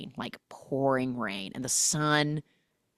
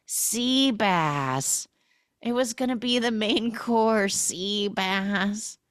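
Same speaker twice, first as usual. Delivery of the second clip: delirious sea bass lament; 7 semitones higher, slower than usual. Retold or imitated. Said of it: "Lizzie, who's losing her mind, is like".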